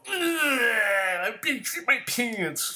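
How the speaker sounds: long high wailing mock bawl; hammed-up whiny baby wail, drawn out; crybaby quote. Host quoting the people he despises, mock bawling. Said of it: "I got one more quote".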